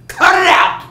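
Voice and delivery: high, full-force scolding shout; exasperated yell. Retold or imitated. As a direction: bellow to break up a fight. Yells at fighting bear and snake.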